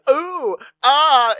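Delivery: mock awed ooh and ah; kids going ooh ah. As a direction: imitate kids gasping in wonder. Kids react in movie.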